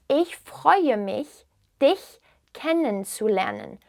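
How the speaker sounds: very slow, phrase broken into pieces; slow repeat. Shown as a demonstration. Teacher repeats the German greeting for learners, slowly and carefully. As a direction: spell it out slowly, word by word.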